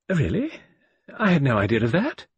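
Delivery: high-pitched, sharp surprise with an edge. A narrator's reading. Wife annoyed with the nurse, sharply feigning interest. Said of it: "Barbara Franklin's voice was sharp".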